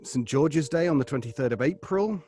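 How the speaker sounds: ordinary talk, nothing marked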